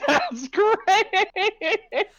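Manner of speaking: high, loud mock cheer; loud squeal. Real, not acted. Friend teasing the host, high squealed cheer.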